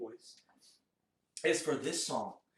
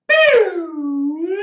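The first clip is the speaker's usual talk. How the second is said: high, loud swooping "boo"; loud vocal glissando; slide whistle imitation; 9 semitones higher, 17 dB louder than usual. Shown as a demonstration. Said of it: "Let's try it together".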